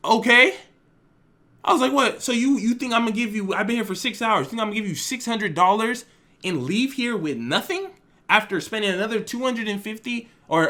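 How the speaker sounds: high, loud outraged disbelief; incredulous anger. Retold or imitated. To the repairman demanding six hundred dollars, loud outraged disbelief. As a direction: argue back, fed up and stunned.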